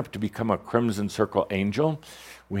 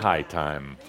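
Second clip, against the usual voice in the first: normal talking voice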